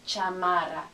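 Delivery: Spanish "Chamarra", every a kept open; full "ah" vowels. Shown as a demonstration. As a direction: model "Chamarra" without any schwa. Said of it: "All the I's say ah".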